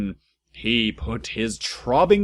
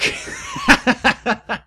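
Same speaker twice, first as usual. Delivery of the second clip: high shrieking laugh; 3 dB louder than usual. Real, not acted. Reader collapses laughing at the fanfic text. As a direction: burst of squealing laughter. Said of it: "reader cracks up".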